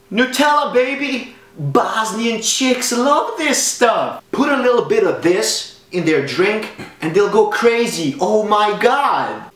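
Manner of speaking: high-pitched, hammed-up sales hype; hyped-up excitement. Comic revealing what Bosnian chicks love, hyped-up excitement. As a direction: pitch it high with wild glee. Unveils his secret weapon.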